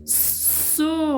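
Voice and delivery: "So" lifted and softened as a lone syllable; sounding out. Shown as a demonstration. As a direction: isolate the syllable "So" for the class. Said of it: "olha o som".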